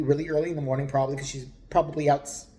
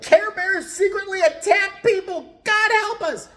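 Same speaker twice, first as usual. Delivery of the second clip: much higher and louder, slow preachy wail; crazed sign lady; 7 dB louder, slower than usual. Acted. Narrator voicing the neighbor's crazy signs, high shrieking prophet voice. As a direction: proclaim like a doomsday prophet.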